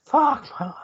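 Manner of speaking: strained groan while lifting; straining. Real, not acted. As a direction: force it out under a load. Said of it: "You all right?".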